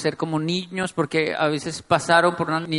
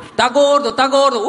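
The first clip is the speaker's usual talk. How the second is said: higher sing-out teasing chant; mock taunting; 5 semitones higher, 3 dB louder than usual. Dad bullying son in jest, mock taunt. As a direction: needle him playfully, each repeat alike.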